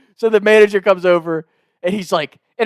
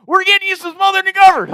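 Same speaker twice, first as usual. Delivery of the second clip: high, loud hammed-up yell; triumphant shout to someone off the phone; mock holler; 5 dB louder than usual. Prank caller shouts refund news to his wife, mock holler.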